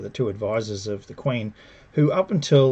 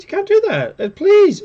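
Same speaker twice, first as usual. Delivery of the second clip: high-pitched mock pleading; imitated desperate plea, rising louder; begging; 8 dB louder than usual. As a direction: beg in a hammed-up high voice. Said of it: "She begs".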